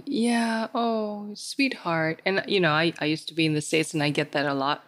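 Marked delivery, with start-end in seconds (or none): 0.0-2.2 mock sweet sympathy. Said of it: "it's easy to just say".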